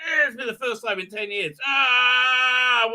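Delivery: mock struggle to lift with the mind; straining with effort. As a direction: act out a laboured heave. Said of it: "actually hard for him to do".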